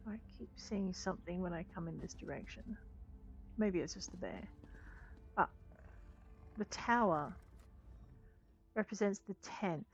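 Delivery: ordinary delivery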